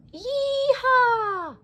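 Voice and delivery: high, loud cowgirl whoop; cowgirl yell. Acted. Cowgirl greeting Woody, high loud whoop.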